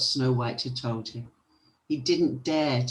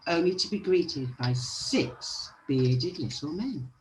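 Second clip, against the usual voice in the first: ordinary delivery, nothing marked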